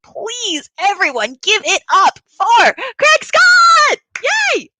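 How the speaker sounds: high, drawn-out MC shout; slow, rising hype into a shout; rousing introduction. Real, not acted. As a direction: build to a big cheer on the name. Host brings on next performer.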